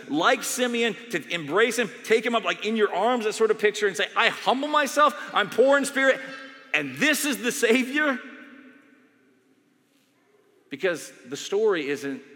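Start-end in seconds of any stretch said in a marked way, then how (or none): 4.2-8.2 high, loud, impassioned; fervent confession. Pretend. Pastor voicing a believer embracing Jesus fervently. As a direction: cry out in humble surrender.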